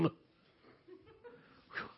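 unvoiced breathy whew of relief after acting; relieved whew. Real, not acted. Preacher recovering from his acted voice, a laughing whew. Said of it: "I can't do that acting very long".

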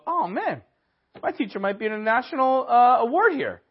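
excited students. Retold or imitated. Students thrilled by the lie.

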